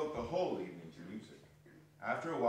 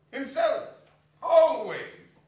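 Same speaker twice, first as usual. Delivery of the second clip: high, loud and insistent; emphatic; 11 semitones higher, 11 dB louder, much slower than usual. Acted. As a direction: hammer each word home. Nephew declares who suffers.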